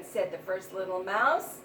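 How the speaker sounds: usual speaking voice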